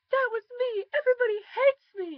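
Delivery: imitating a ten-year-old's screaming tantrum; tantrum wail. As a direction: scream it like a child throwing a fit. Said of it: "she threw a fit".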